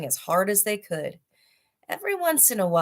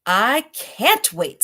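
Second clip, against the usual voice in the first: eager tiger; 4 semitones higher, 5 dB louder, much slower than usual. Acted. Seventh hungry tiger speaks.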